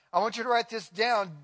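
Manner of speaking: usual speaking voice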